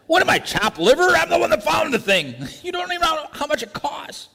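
high, put-out indignant voice; offended giver. Acted. Giver ignored while the gift is loved, indignant whine. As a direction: sound slighted and huffy.

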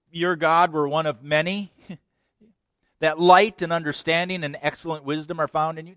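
high, loud mocking flattery; sarcastic king. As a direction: flatter with a sneer.

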